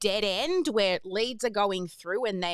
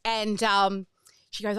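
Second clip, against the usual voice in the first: ordinary talk, nothing marked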